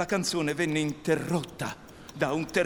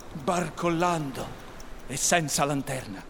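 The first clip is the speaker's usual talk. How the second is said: ordinary delivery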